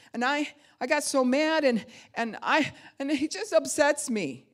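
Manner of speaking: high, loud, stumbling outburst; revved-up upset child. Retold or imitated. Imitating foster boy who finally got the stick, worked up. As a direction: blurt the grievance, tripping over the words. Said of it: "he was so revved up".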